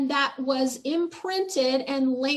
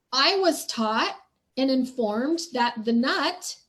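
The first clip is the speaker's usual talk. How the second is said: normal talking voice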